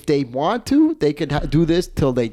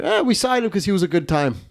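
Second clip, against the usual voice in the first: quoting the manager; 7 semitones higher than usual. Retold or imitated. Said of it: "it cuts to the manager".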